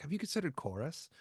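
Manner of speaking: high-pitched Mid-Atlantic invitation; Mid-Atlantic accent. Retold or imitated. Chorus teacher recruiting a student, Mid-Atlantic accent. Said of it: "the Mid-Atlantic thing".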